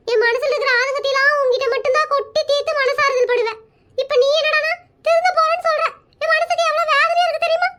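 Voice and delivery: wounded mock-weepy complaint; fake crying. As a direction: plead as if hurt, faking the tears. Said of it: "இப்ப எதுக்கு அழுதுட்டு இருக்கிற".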